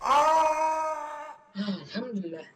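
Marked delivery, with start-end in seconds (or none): 0.0-1.4 long drawn-out high wail of pain; anguished cry. Real, not acted. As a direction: stretch the ah into a high, crying howl. Game moment, cry heard.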